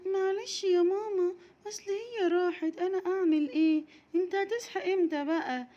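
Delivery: put-on high pleading child voice; a small girl pleading for patience; whiny little girl. Raghad pleading with mama, high whiny child voice.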